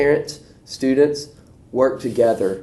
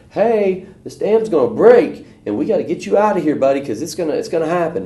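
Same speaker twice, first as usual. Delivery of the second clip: high, louder pressing warning; urgent rescuer; 8 semitones higher, 4 dB louder than usual. Retold or imitated. Rescuers in a bigger truck warning the man, high and urgent. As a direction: push him to get out now.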